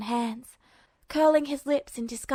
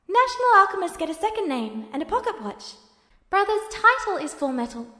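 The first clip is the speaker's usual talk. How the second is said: put-on higher, lighter young voice explaining; bright little brother voice, raised; Alphonse's voice; 7 semitones higher, 3 dB louder than usual. A narrator's reading.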